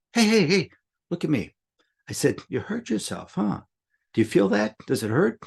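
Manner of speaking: put-on high, sing-song voice for a small child; talking to a toddler. Man calming a hurt preschooler, high gentle coaxing. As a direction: coax the little girl to look at you.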